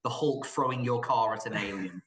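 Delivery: ordinary talk, nothing marked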